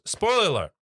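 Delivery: loud, high announcement; spoiler alert call. Acted. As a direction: call out the warning.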